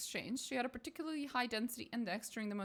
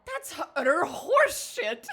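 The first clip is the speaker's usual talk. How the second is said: shouted through laughter, much higher; laughing outburst; 14 dB louder than usual. Real, not acted. Player cracking up while calling the theory horseshit, laughing loud. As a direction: burst out laughing mid-insult.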